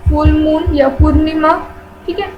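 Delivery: usual speaking voice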